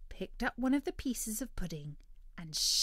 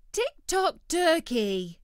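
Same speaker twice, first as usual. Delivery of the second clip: disappointed Future Dog; 9 semitones higher, 9 dB louder, slower than usual. Acted. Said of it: "very disappointed".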